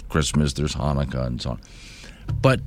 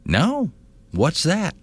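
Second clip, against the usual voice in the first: put-on puzzled young person; 9 semitones higher, slower than usual. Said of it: "the answer I get from them is".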